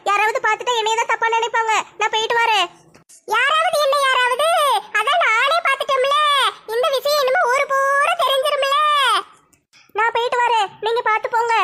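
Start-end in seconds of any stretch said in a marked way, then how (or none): 3.3-8.8 indignant confrontation. Acted. Aunt confronts the couple she has caught, indignant. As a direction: pitch it up and stretch the accusing words.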